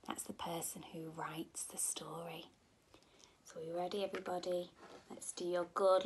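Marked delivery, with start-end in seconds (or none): 0.1-2.5 soft whispered aside. Real, not acted. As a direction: whisper it like a secret.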